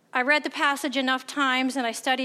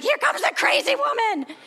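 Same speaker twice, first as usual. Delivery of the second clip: much higher, mock-alarmed clerk voice; imitating the nervous clerk; 13 semitones higher, 4 dB louder than usual. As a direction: squeak it like the scared store guy.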